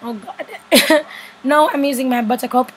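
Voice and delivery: usual speaking voice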